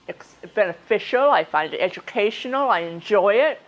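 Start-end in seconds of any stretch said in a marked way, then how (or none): none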